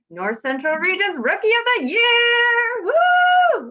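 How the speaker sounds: soaring, drawn-out hype announcer with a loud high whoop; hyped announcement. Real, not acted. Host introducing the award-winning guest to the Zoom audience, hyped. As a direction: build up to a loud, stretched cheer.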